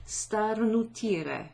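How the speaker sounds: Starnutire drawn out slowly, syllable by syllable; slow showcase word. Shown as a demonstration. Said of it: "this awesome little verb".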